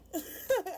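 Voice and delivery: a short high burst of laughter; high-pitched laugh. Real, not acted. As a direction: laugh out loud at the memory. Laughing at the story.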